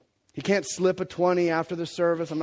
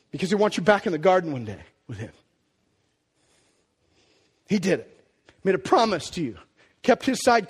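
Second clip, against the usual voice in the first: ordinary talk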